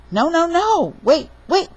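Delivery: high, loud storybook alarm; alarmed protest. A narrator's reading. Papa facing a bath-time attack, alarmed protest. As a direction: plead loudly and high to hold off. Father alarmed, pleading to stop.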